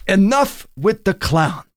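high, slow menacing thug; lightly voiced angry gangster; threatening mobster.

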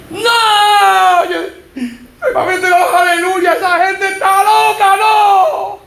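high laughing protest; high mocking yell through laughter; retold shouting. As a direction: shout scorn at the believers. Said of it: "Yo estaba gritando".

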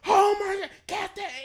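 high and loud, near tears with joy; mimicking friend's overjoyed reaction; excited disbelief. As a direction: squeal like you just won. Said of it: "And this".

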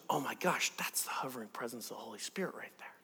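hushed, awed whisper of discovery; whispered amazement at a holy presence. Retold or imitated. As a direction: breathe it out in wonder. Paul sees the Spirit, awed.

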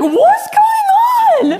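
put-on very high, slow, laughing baby voice; baby's puzzled voice. Baby Jed asking what's going on, high laughing voice. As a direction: stretch it out like a bewildered baby. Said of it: "He was just like".

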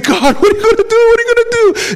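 hammed-up, very high panicked squeal; freaking out. Moses pleading with God as Pharaoh closes in, comically freaking out. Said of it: "he's freaking out".

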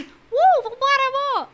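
high, loud party whoop; excited cheer. Retold or imitated. Wife dancing at home, whooping at her husband. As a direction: whoop to get him dancing. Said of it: "eu pego assim".

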